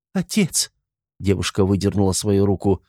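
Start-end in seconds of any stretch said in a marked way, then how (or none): 0.1-0.7 high, sudden cry of grief; anguished cry. A narrator's reading. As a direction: cry out in shock. Daughter sees father die.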